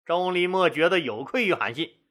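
plain reading voice

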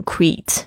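create said as one syllable, like "kreet"; mispronounced create. Shown as a demonstration. Teacher mimics how students first read it, mispronounced create. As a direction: run create together into "kreet". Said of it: "很多的学生第一次在读这个字就会想要讲成".

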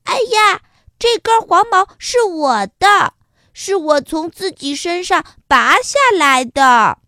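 loud, high exclaiming rabbit; excited cry. Acted. Rabbit crying out to the ant that the hair is his, loud. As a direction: call out in surprise to claim it. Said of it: "叫了起来说".

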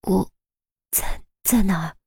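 weak, quiet rasp through a parched throat; barely able to get the words out; dry strained throat. Acted. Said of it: "发声竟然有些困难，嗓子里干干的".

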